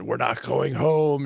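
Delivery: imitating a heavy Russian accent. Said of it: "in his heavy Russian accent".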